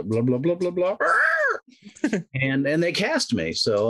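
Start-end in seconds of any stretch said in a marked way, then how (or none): 0.0-1.0 alien voice. Retold or imitated. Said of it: "my delivery was". 1.0-1.6 shrill high bird squawk. Retold or imitated. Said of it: "an occasional squawk of joy".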